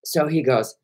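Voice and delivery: ordinary talk, nothing marked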